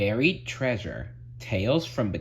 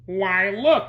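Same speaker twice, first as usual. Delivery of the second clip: lightly put-on, higher voice; louder, raised cartoon delivery; Squidward, pointing out; 11 semitones higher, 6 dB louder than usual. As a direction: feign helpful surprise. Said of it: "he said, pointing".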